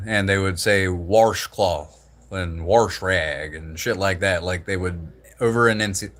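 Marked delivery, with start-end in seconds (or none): 0.9-2.1 "washcloth" with an added R, like warsh-cloth; over-pronounced R in the first syllable of "washcloth"; Tennessee pronunciation. Retold or imitated. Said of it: "over-enunciate their R's". 2.6-3.6 "wash rag" with an added R, like warsh rag; slow "wash rag" with a heavy R in wash; Tennessee pronunciation. Retold or imitated. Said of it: "over-enunciate their R's".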